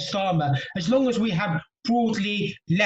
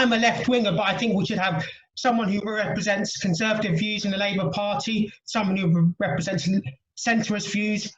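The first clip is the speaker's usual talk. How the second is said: usual speaking voice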